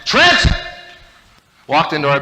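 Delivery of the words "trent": high, loud shout across the house; calling out a name. Retold or imitated. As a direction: yell for the missing son. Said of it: "started calling out my brother's name".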